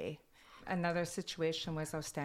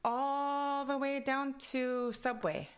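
put-on higher, slow Kwakwala-accented English; 9 semitones higher, 3 dB louder, slower than usual. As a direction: draw it out in the accent. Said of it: "my accent from Kwakwala goes into English".

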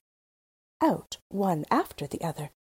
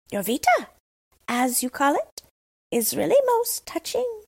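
higher, genteel lady crow; tame Crow; 6 semitones higher than usual. Acted. The tame Crow welcoming Gerda, higher and formal. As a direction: greet the little girl with courtly manners.

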